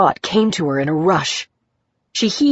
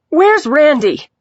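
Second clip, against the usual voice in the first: high, loud, drawn-out demand; agitated cry; 9 semitones higher, 5 dB louder, slower than usual. A narrator's reading. Agitated patient asking after her man, loud cry. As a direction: call it out loudly, falling at the end.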